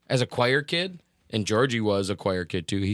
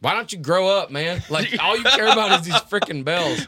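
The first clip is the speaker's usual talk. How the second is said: raised, scolding retort, retold; mock outburst at another kid, pitched up; hammed-up childish exasperation; indignant twelve-year-old; 8 semitones higher, 6 dB louder than usual. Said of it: "With the accent and everything. As a twelve-year-old".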